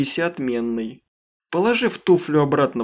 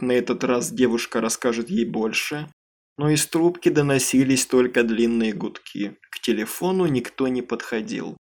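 ordinary delivery

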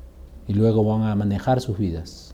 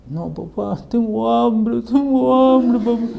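put-on high, drawn-out wail of a hungry child; mock crying child; 9 semitones higher, slower than usual. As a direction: whine it hammed-up, pleading with papá. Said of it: "estás llorando por". (llanto de niña imitado, muy agudo)

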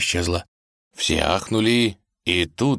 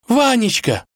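high, glad shout; princess's high cry; crying out in reply; 4 dB louder than usual. A narrator's reading. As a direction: call back, pitched way up. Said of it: "крикнула в ответ она".

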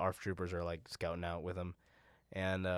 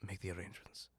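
Sham's voice; 7 dB quieter than usual. Retold or imitated. Sham's voice, low.

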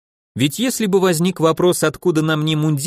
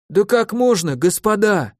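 weak indignant protest; 6 semitones higher than usual. A narrator's reading. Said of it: "возмущались уже слабым голосом".

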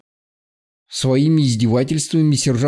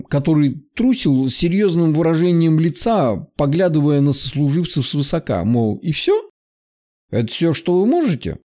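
ordinary delivery, nothing marked

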